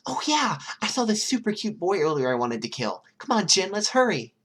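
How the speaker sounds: high, loud and eager; excited. A narrator's reading. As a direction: burst out with thrilled energy. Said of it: "Toga gasped in excitement".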